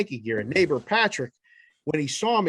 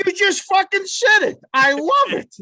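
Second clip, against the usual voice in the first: higher and louder, thrilled laughter in the voice; delighted outburst; 13 semitones higher, 6 dB louder than usual. Real, not acted. Host delighted his co-host keeps jinxing the team, excited. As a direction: burst out with real delight at the jinx.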